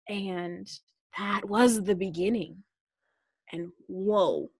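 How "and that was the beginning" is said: ordinary talk, nothing marked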